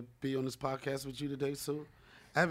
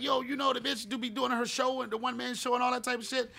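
higher, excited rush; excited gush; 9 semitones higher than usual. Retold or imitated. Guest telling host about Sue, a touch higher and excited. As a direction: gush about her show with delight. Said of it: "I was like".

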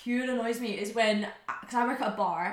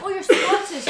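high, loud mock exclamation; mocking imitation; 10 semitones higher, 10 dB louder than usual. Imitating outsiders who lump all Scots together, high and mocking. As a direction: blurt it out high, sending them up.

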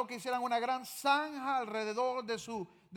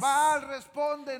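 slow, louder cry to Baal; mock desperate shout; 3 semitones higher, 6 dB louder, slower than usual. Preacher acting prophets crying to Baal, slow and loud. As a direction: plead with a god who never answers. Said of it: "y le gritaban".

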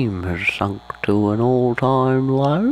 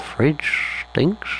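ordinary delivery

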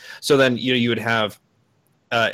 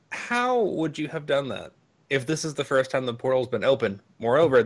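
ordinary talk, nothing marked

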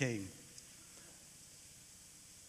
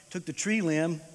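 normal talking voice

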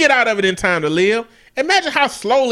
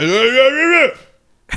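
mumbled slurred yeahs; mock a slurring rapper; lightly slurred mumbling impression; 3 dB louder than usual. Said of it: "he came out there and was like".

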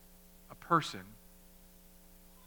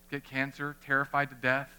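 normal talking voice

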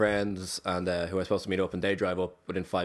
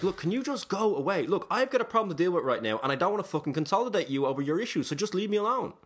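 much higher, fed-up pleading; exasperated brush-off; 9 semitones higher than usual. Retold or imitated. Man telling a clinging woman to leave, exasperated. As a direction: retell it at the end of your patience.

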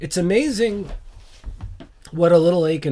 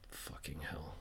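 whispered curse; 9 semitones lower, 22 dB quieter than usual. Real, not acted. Muttered aside after failed impression.

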